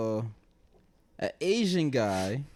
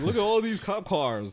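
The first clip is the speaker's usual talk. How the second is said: mock caller; 8 semitones higher than usual. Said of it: "He's like".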